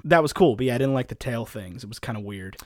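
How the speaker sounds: ordinary talk, nothing marked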